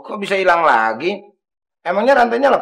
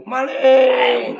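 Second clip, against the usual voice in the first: shout; 5 semitones higher than usual. Pretend. Mock shout of thief.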